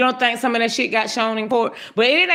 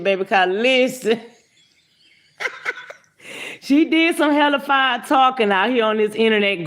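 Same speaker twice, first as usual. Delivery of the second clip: normal talking voice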